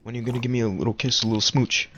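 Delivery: fast, teasing voice of a pushy friend; hammed-up teasing buddy; mocking friend. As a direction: rattle off the come-on like a joking buddy. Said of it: "He was like".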